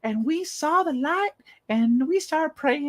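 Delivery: high, slow, awestruck churchy voice; mock pious women. Mocking the women on TV telling their story, high and slow. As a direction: ham up a starry-eyed believer, drawn out and high. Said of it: "They're like".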